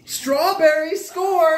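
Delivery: high, slow triumphant shout; excited cheer. Real, not acted. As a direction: celebrate loudly. Cheering a good flavor.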